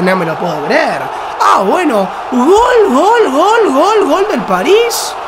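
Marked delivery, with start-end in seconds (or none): 2.3-5.1 high, shouted goal call. Real, not acted. Goal celebration.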